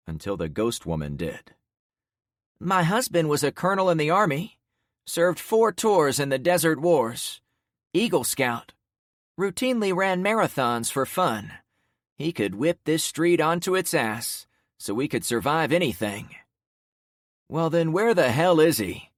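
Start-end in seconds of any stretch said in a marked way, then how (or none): 2.6-16.3 proud widow. Acted. Widow praises husband. 17.5-19.1 loud, raised taunting question; needling. Acted. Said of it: "one of the men needled her".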